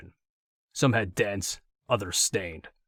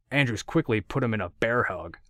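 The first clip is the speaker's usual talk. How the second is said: ordinary delivery, nothing marked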